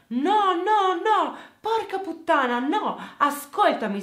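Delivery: shouted high and loud, no no no; furious shout. Retold or imitated. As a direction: yell it out in rage, high-pitched. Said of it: "Davide a questo punto urla". (urlo furioso, acuto e forte)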